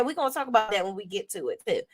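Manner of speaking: usual speaking voice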